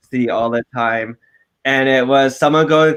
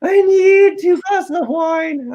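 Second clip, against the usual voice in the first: high, slow, drawn-out dramatic demand; mock-desperate plea; 5 dB louder, slower than usual. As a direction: wail the order loudly for comic effect. Acting out his desperate drink order.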